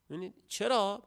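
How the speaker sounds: normal talking voice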